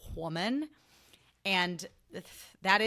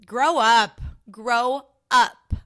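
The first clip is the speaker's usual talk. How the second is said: much louder shout of "grow up"; shouted catchphrase; 3 semitones higher, 14 dB louder, much slower than usual. Real, not acted. Closing her Grow Up rant to listeners, shouting. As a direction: bellow it as the final verdict.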